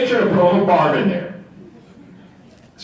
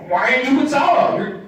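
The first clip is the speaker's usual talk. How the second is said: higher, indignant mock scolding; mock angry Moshe; 9 semitones higher than usual. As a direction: scold him in jest.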